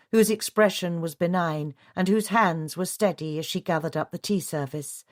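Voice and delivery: usual speaking voice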